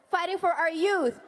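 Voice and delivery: usual speaking voice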